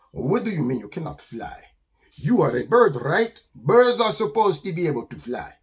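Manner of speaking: put-on accented, incredulous skunk; Armando the skunk. Skunk to a bird who cannot fly, incredulous. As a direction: question it with deliberate, accented emphasis.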